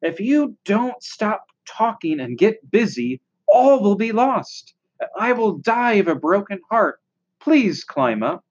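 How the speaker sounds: earnest, mounting plea; desperate. A narrator's reading. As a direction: plead as if all is at stake. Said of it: "said Wilbur in desperation".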